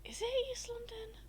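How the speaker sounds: high, whispered question to self; hushed puzzling. Real, not acted. Streamer guessing where an accent is from, hushed puzzling. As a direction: whisper it softly, unsure.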